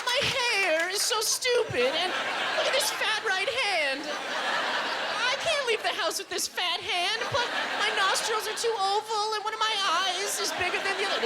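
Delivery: put-on high, whiny girl voice. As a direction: complain like a girl at the mirror.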